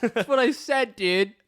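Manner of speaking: high-pitched, exasperated protest; aggressive insistence. Real, not acted. Misheard friend repeating it after being called aggressive, high exasperated insistence. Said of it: "someone's getting aggressive".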